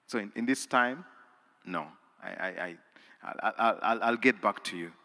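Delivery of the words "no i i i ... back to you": usual speaking voice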